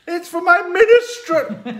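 high, loud mimicry breaking into a laugh; mock whine laughing. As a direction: squeal it back while laughing.

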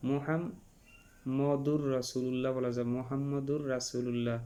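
মুহাম্মদুর slowly, with a rounded "mu" start; correct recitation. Shown as a demonstration. Teacher models the Quranic wording for students: slow, careful recitation. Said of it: "মোহাম্মদ বলা যাবে না".

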